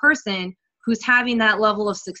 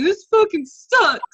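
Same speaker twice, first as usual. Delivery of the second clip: high, slow, strained moaning complaint; retold crying despair; 8 semitones higher, 4 dB louder, slower than usual. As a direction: relive the couch-crying moment. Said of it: "on my couch crying, going".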